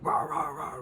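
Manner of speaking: rough "rah rah rah" dog noises; imitated dog bark. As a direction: bark like a big worked-up dog. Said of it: "like that, and she has a big fucking bark".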